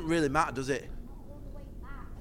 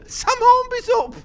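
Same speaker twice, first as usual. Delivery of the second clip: higher, rising excitement; excited cry; 4 dB louder, slower than usual. Real, not acted. Repeated, higher.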